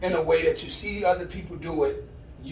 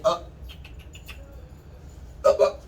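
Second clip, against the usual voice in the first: command up; 2 semitones higher than usual. Real, not acted. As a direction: snap the dog up on its feet. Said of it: "commanding dog up".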